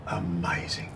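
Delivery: low, whispered awe; hushed amazement. Acted. Reporter marvels at the proof on the old tape, hushed amazement. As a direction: breathe it out softly, awestruck.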